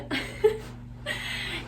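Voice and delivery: soft, breathy little laugh; soft chuckle. Real, not acted. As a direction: chuckle lightly to yourself. Chuckles after showing the pillow.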